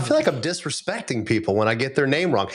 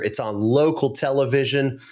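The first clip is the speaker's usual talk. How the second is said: ordinary talk, nothing marked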